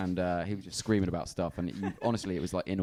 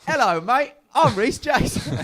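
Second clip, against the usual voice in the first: high, put-on Cockney accent; nervous schoolboy faking a Cockney accent; weird Cockney voice; 6 dB louder, slower than usual. Schoolboy giving his head boy speech, in a high Cockney accent. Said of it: "doing this weird Cockney voice".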